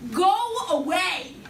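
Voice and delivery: a half-acted cry pushing Jesus away; pleading go away. Peter retold, falling at Jesus' feet.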